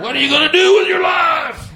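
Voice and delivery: high, loud mock-parent yell; mock dad shout. Comic mimics a nagging dad scolding a student, shouting high. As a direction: bellow it like a fed-up father. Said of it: "I'm sounding like your dad".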